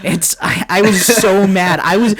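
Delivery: ordinary delivery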